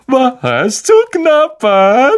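hoch, langsam und einschmeichelnd; süßlich-listiger Poltergeist lockt; schmeichlerisch. Acted. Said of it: "sagte er schmeichlerisch". (schmeichlerisch und hoch)

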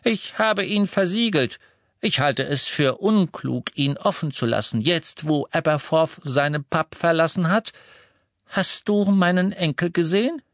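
firm, no-nonsense grandmother; brisk old lady. A narrator's reading. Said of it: "sagte Misses Longbottom".

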